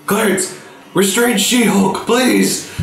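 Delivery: high, urgent pleading plea; pleading mental call. Acted. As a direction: beg Clarence with a laugh. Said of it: "I'm gonna mentally".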